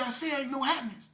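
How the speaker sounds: loud, high, fed-up refusal; a man yelling through the door, annoyed; irritated shout. Retold or imitated. Man inside refuses again.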